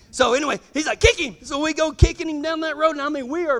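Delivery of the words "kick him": high, loud bark; urgent command. Retold or imitated. Cowboy to rider starting another run, urgent shout. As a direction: spur the rider on sharply.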